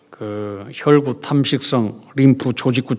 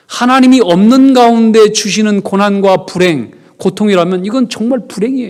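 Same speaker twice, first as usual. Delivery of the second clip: loud, high fervent sermon; preaching at full force; 8 semitones higher, 6 dB louder than usual. Acted. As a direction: preach it with rising fire. Prédication enflammée.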